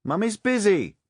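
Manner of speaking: mother calling back, high and loud; shouted back. A narrator's reading. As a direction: shout it to a child in another room. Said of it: "she shouted back".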